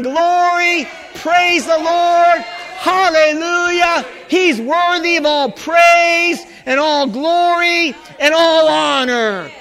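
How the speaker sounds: high, drawn-out preacher's praise cry; exultant pulpit shout, slow and ringing. Shown as a demonstration. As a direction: shout praise with long, held words. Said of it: "you need to start shouting".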